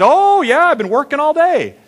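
very high, loud granny imitation; hammed-up high grandma. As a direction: mimic grandma way up high, emphatically. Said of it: "can't do my grandma voice very well".

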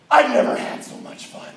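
put-on high, breathy old man's exclamation; elated old man. Worn-out uncle telling the DJ after dancing, elated breathy voice. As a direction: gasp it out, thrilled and worn out. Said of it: "I literally heard him say".

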